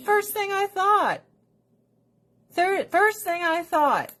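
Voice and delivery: high squeal dropping at the end; emotional squeal. Retold or imitated. Recalling first sight of friend's weight loss, high squeal. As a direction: squeal it, then let it fall.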